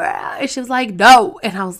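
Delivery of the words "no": firm loud no. Retold or imitated. Mom flatly refusing.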